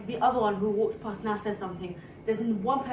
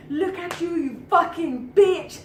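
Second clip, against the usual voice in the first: higher mock abuse, building louder and slower; imitated angry insult; 6 semitones higher, 6 dB louder, slower than usual. Friend imitating a passer-by insulting her, mock angry. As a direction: throw a drawn-out insult like the man passing.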